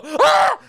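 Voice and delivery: very high, loud mock gasp-scream; shocked scream. Audience reacts to the obscene outburst, high mock scream. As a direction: imitate a horrified crowd crying out.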